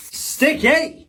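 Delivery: high-pitched playful catchphrase; high squeaky exclamation. Pretend. Catchphrase shouted at each sticker.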